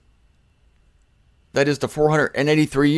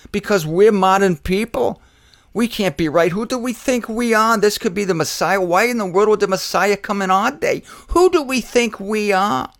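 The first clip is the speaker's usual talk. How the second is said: higher, incredulous scoffing questions; mock indignation; 7 semitones higher than usual. Preacher mimicking proud modern skeptics, raised incredulous voice. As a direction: play the scornful skeptic.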